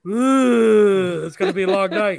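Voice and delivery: a mock foreboding ooh; wry dread at the declined card; ominous ooh. Retold reaction to declined card.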